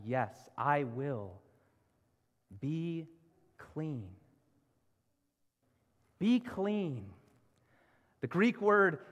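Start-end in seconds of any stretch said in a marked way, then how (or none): 0.1-4.1 slow, low, deliberate emphasis; emphatic answer. Acted. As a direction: give each word weight, unhurried, as Jesus answering. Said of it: "Jesus answers emphatically".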